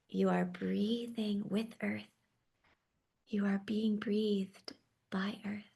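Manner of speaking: normal talking voice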